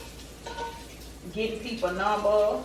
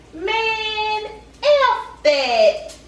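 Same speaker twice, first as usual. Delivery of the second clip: indignant outburst; 9 semitones higher, 5 dB louder, much slower than usual. Real, not acted. Her outraged aside.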